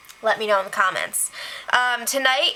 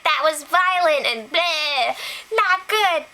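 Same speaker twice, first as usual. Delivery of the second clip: higher-pitched mock outrage; mock anger; 5 semitones higher, slower than usual. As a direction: imitate an angry housemate complaining. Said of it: "Sabrina was really angry".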